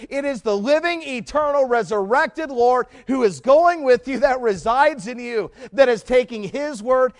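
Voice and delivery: high, loud, fervent preaching; preaching at full force. Acted. Preacher rallying the church to share the gospel, loud and fervent. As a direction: lift it and drive every line home.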